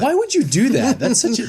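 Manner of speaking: high laughing disbelief; shocked laughing protest; surprised. Real, not acted.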